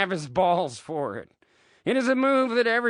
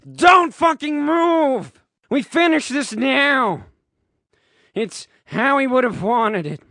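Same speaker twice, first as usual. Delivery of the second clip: screaming; 7 semitones higher, 7 dB louder than usual. A narrator's reading. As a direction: scream the order with full force. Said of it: "Harry screams this".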